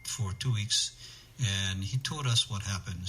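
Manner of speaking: usual speaking voice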